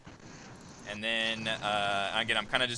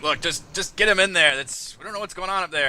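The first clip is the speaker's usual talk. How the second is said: mildly raised guard voice; 8 semitones higher, 8 dB louder than usual. Acted. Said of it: "The other one says".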